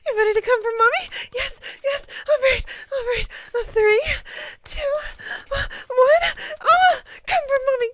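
loud, high, urgent cries; frantic, rising encouragement; climactic urgency. Acted. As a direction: push the voice up towards a climax. Acted climax countdown in roleplay.

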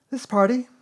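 put-on high, loud boy's announcement; Christopher Robin. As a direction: announce it as a proud little host. Christopher Robin gives a speech.

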